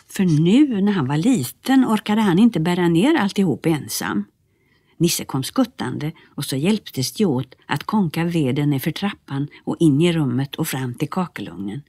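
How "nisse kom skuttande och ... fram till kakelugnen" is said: ordinary delivery, nothing marked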